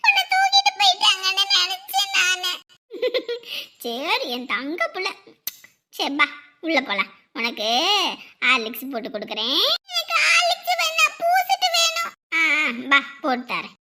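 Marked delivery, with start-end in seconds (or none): 0.1-2.5 put-on a frightened little child shouting for mom; child calling mother. 9.9-12.1 put-on high, slow whiny child; a lightly voiced little kid asking for a treat; child's voice.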